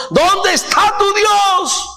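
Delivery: high, slow jeering voice of the scoffers; mocking taunt. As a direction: drag out the mockery at the believer. Mockers taunt the psalmist. (burla lenta y aguda de los que se mofan)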